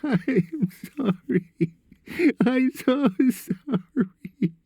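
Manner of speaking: very high, slow sobbed apology; crying hard. A narrator's reading. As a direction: sob each word out high and drawn out. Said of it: "they heard him crying loudly".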